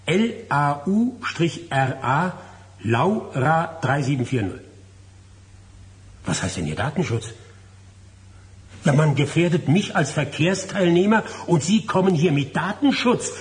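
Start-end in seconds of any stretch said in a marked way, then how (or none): 0.1-4.6 slow spelling out. Acted. Man dictating the licence plate slowly on the phone. 8.8-13.3 higher, louder, furious complaint; indignant outburst. Acted. Man on the phone angrily protesting to the traffic office.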